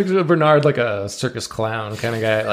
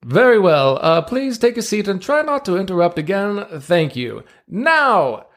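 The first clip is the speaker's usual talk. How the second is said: higher pitched ringmaster patter, slow and grand; showman ringmaster; 10 semitones higher, slower than usual. Acted. Ringmaster presenting seals to the crowd, grand showman voice. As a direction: play the circus ringmaster working the crowd.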